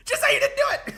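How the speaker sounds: high, loud, disbelieving cry; exasperated plea. Real, not acted. Streamer pleading at Doc in disbelief, high and loud. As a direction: beg him through laughter.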